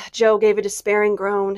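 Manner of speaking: ordinary delivery, nothing marked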